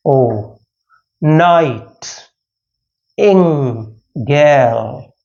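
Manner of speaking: "oh, night, ing, gal" broken into long, separate syllables. Shown as a demonstration. Teacher showing meter to students, "oh, night, ing, gal" split into syllables. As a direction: count out "oh, night, ing, gal" slowly with gaps between. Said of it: "let's look at pentameter".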